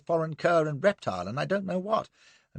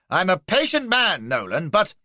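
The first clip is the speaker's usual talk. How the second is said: loud, higher warning cut short; stern anger; 7 semitones higher, 5 dB louder than usual. A narrator's reading. Colonel warns Nolan and blurts out his name. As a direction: warn him, then stop dead.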